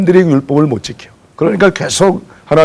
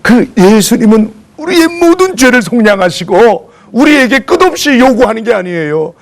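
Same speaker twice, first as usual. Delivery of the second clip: fervent preaching; 7 semitones higher than usual. Real, not acted. Preacher lifting his flock with fervor. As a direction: lift the congregation up with it.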